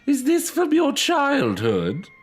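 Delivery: high, slow, loud entranced voice; drawn in by the doll, strangely; eerie delighted lady. Acted.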